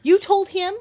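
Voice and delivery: high, loud incredulous question; indignant surprise. Real, not acted. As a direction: burst out as if the secret just got spilled. Sudden reaction to a revealed secret.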